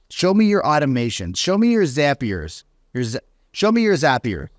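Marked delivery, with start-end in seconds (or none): none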